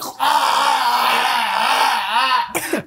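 long, high creature yell; drawn-out hammed-up shriek; mock strangled scream. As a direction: cry out like a throttled dragon. Acting out the strangled dragon.

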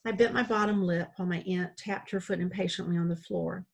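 ordinary delivery

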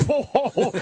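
high, mock-amused whoa; amused Barnes reaction. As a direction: imitate a tough guy tickled by a joke. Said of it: "Barnes looks down like".

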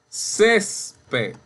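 Césped split CÉS-ped, high on CÉS; stress on first syllable. Shown as a demonstration. Teacher sounding out Césped, stressed CÉS-ped. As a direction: punch CÉS in Césped, pause, drop ped. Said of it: "la sílaba tónica".

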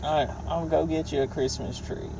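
ordinary delivery

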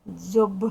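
Дзьоб loud, ending on a ringing B; loud teacher's demo of Дзьоб with final B; voiced final consonant. Shown as a demonstration. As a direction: punch out Дзьоб with a voiced Б, never P. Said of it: "дзвінко".

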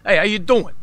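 loud, high New York greeting; big brash New York impression; heavy New York accent. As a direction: hail a buddy in thick New Yorkese. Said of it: "really heavy New York accent".

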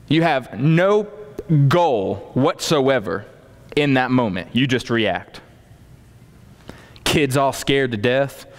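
normal talking voice

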